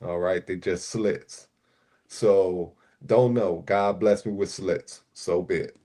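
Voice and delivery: normal talking voice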